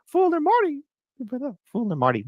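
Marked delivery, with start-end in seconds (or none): 0.1-0.8 squeaky high cartoon mouse voice; Mickey Mouse voice. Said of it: "how about with Mickey".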